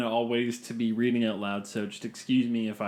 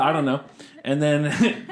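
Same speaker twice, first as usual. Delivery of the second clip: ordinary delivery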